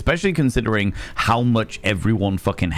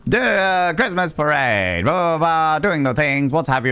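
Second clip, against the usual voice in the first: mock tour guide; 5 semitones higher than usual. Guide on the parade bus mocking passers-by, hammed-up announcer. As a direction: ham up the posh tour commentary. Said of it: "And I'm like".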